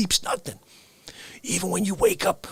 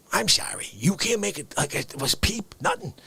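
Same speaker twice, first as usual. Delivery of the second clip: normal talking voice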